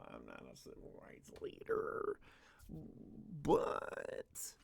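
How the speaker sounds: hushed, breathy whisper; whispered aside. Real, not acted. Streamer whispering a sly jab at Seth.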